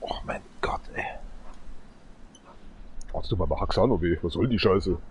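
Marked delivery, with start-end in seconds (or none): 0.0-1.1 whispering. Real, not acted. Unvoiced whisper heard.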